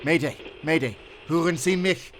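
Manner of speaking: loud, high pleading into the radio; desperate radio call. Acted. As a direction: call out again, hoping someone answers. Calling again on dead radio.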